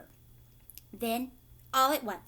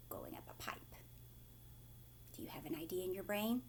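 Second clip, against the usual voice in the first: whispered question; 5 semitones lower, 15 dB quieter, faster than usual. Real, not acted. Teacher whispering question to kids.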